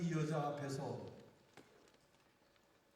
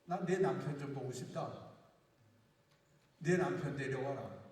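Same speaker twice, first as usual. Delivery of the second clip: lower, calm, authoritative; Jesus speaking; 6 semitones lower than usual. Retold or imitated. Jesus answering the woman at the well, low and calm. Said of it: "예수님께서 뭐라고 그랬는지 알아요?".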